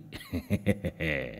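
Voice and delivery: low, soft gloating chuckle; sly chuckle. A narrator's reading. Old man gloating over his scheme, chuckling slyly. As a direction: chuckle quietly to yourself.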